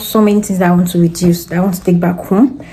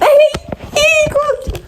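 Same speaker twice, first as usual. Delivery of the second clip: shrieking nervous laugh; high squealing laugh. Real, not acted. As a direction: squeal with half-crying laughter. Panicked laugh about phone.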